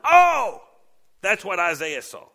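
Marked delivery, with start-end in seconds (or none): 0.0-0.7 high loud cry as if doubled over; pained cry. Shown as a demonstration. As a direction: cry out as if struck. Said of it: "you just double over".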